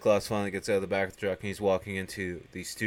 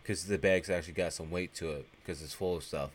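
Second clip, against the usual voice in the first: ordinary talk, nothing marked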